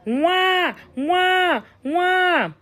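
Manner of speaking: put-on high, wailing baby cry from the forest; otherworldly high wah-wah wail; eerie baby wail. A narrator's reading. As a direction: imitate a distant crying infant. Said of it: "came the cry again".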